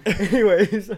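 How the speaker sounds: laughing it off, high; breaking into laughter. Real, not acted. Laughing after punchline.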